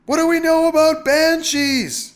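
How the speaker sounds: high, loud desperate question; husband yelling at high pitch; crying out. A narrator's reading. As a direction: shout it over a terrible din. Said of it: "he cried".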